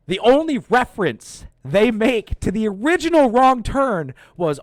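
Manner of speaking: loud, high, emphatic complaint; indignant rant. Real, not acted. Reviewer griping about the film's nod to Wrong Turn, indignant. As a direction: push every word up high in outrage.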